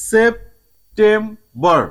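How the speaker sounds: "September" in three slow, separate beats; very slow, syllable-by-syllable "September"; split into syllables. Shown as a demonstration. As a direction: pause between each part: "সেপ-টেম-বর". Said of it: "তিন ভাগে ভাগ করা যাবে".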